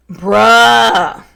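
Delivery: loud, drawn-out exclamation; acted exasperated call; exasperated outburst. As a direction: stretch it out loudly in exasperation. Reads Dom's exasperated text aloud.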